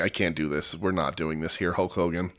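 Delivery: soft, low, suppressing a giggle; quiet giggly refusal. Retold or imitated. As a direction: murmur it through a held-back laugh. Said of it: "I would just giggle and I'd be like".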